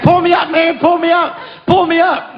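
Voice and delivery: frantic friend begging from the water; scared plea. Retold or imitated. Friend dragged beside the raft begs to be pulled up, panicked. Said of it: "he's so scared".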